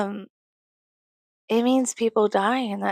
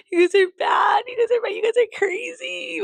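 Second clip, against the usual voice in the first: very high squealed teasing; mock scolding; 4 dB louder than usual. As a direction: squeal a playful scolding in singsong. Playfully scolds AI therapy users.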